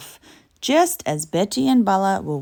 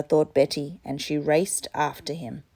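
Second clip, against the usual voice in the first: plain reading voice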